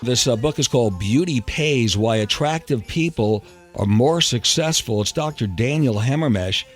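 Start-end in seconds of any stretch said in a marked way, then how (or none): none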